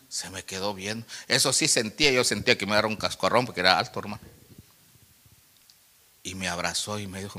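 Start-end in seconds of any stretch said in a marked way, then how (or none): none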